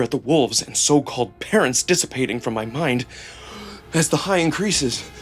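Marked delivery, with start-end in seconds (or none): none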